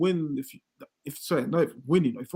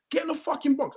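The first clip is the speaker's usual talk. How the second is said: mock stand shout; 8 semitones higher, 3 dB quieter than usual. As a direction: bark it at him like a fan on the terrace. Yelling at striker from stands.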